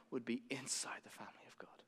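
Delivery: whispered prayer. Shown as a demonstration. Preacher praying for his witness, whispered. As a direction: drop to a hushed prayer.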